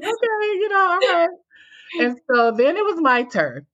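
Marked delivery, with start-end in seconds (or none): 0.0-1.3 laughing puzzled. Retold or imitated. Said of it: "I'm like".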